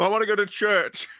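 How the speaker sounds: put-on high eager child voice; childlike Pinocchio. Pinocchio asking to go to church, high childlike voice. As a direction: say it like an innocent kid.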